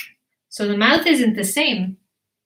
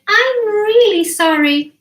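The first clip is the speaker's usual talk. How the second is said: much higher little-one voice; apologetic baby dinosaur; 11 semitones higher, 4 dB louder than usual. Acted. Baby dinosaur apologises for not eating meat, apologetic. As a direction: apologise in a high, earnest baby voice. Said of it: "And he said".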